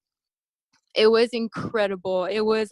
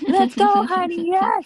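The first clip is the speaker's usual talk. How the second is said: high, loud hammed-up cheering mom; mean girl's mom; 10 semitones higher, 6 dB louder than usual. Coach cheering from the crowd like a mean girl's mom. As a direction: cheer like a pushy pageant mom. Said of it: "like the mean girl's mom".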